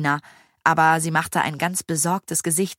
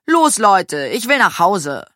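louder, impatient whine; pestering whine, pushed louder; whiny; 3 semitones higher, 6 dB louder than usual. A narrator's reading. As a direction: nag to get going. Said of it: "quengelte Maya".

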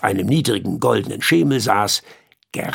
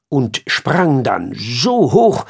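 usual speaking voice

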